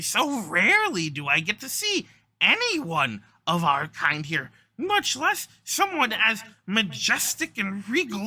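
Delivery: high, loud, gushing little creature; admiring kobold. Acted. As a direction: fawn over an honored guest in a kobold voice.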